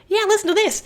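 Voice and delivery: very high elf voice; high-pitched elf; squeaky elf. Retold or imitated. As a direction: chirp like a cheeky elf. Elves voiced.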